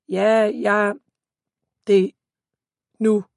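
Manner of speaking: choppy words cutting out like a bad call; phone breaking up. Brother on a bad phone line, words cutting out. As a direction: let the line drop out between words. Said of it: "Sander hakker i det".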